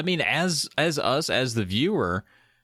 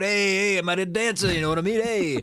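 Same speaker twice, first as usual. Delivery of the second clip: higher, street-tough New Jersey swagger; tough guy New Jersey voice; 6 semitones higher than usual. Retold or imitated. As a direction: play the street kid with attitude, half-mocking. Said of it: "he's got a bit of a tough guy attitude".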